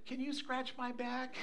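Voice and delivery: put-on soft little kid request; pleading grandchild. Grandchild asking grandpa at bedtime, small soft plea. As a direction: imitate a child asking sweetly, quietly.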